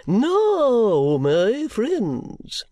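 high, slow, unctuous sermonising; oily preacher voice, drawn out; pompous preacher. Acted. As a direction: play the greasy self-satisfied preacher. Chadband sermon.

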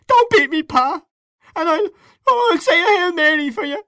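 put-on very high, tearful child's pleading; shaking with fright. A narrator's reading. Boy pleads with father to stop beating, crying in fright. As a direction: beg in a loud, terrified wail. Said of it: "his voice shook with fright".

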